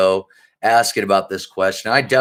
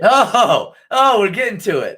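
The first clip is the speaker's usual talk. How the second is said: excited; 9 semitones higher, 5 dB louder, slower than usual. Real, not acted. Co-host teases a controversial topic.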